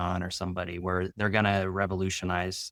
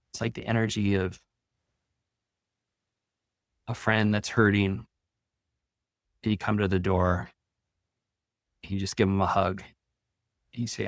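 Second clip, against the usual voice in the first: calm, soothing meditation read with long pauses; guided meditation. Acted. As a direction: ease listeners into their breath, unhurried.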